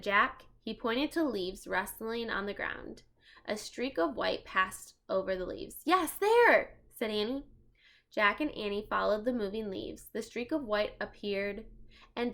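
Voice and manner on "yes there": excited. Acted. Spotting mouse.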